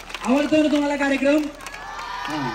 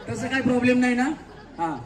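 ordinary talk

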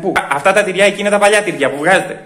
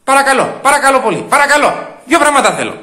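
high, raised, insistent pleading; exasperated demand; 7 semitones higher, 4 dB louder than usual. Acted. Customer wanting only two things, exasperated. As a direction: insist on being served, voice up. Demanding service after outburst.

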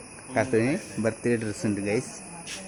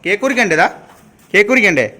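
calling out; 7 semitones higher, 9 dB louder than usual. Real, not acted. Calling child to cut cake.

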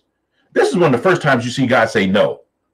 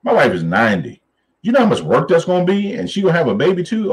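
exasperated Abraham; 6 semitones higher than usual. Retold or imitated. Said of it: "he's like".

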